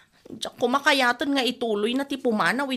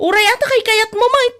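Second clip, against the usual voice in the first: much higher, louder yell; angry shout; 10 semitones higher, 11 dB louder than usual. Acted. Wife yelling defiantly at her husband.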